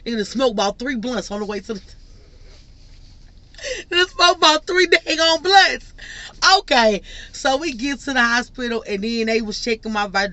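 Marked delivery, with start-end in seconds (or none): 3.9-5.9 higher and louder, words cracking with laughter; laughing. Real, not acted. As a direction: laugh through the line. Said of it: "laughing retelling".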